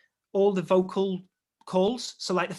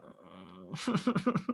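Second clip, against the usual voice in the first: deflated oh; 10 semitones lower, 6 dB quieter than usual. Retold or imitated. Hearing "some news", deflated.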